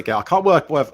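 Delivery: ordinary delivery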